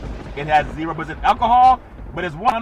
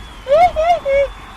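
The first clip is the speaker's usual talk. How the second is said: high squealing giggle; 5 dB louder than usual. Pretend. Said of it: "[name] doing play-by-play".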